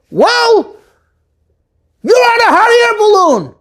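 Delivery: high shout up to the sky; shouted reply. Acted. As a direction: call the obvious answer up to them. Man shouts answer up to balloon.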